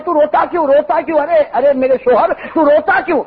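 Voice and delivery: high, repeated pleading questions; insistent wife, acted. Wife pressing her sobbing husband, acted insistence. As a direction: press for an answer in a mock-frantic wife's tone.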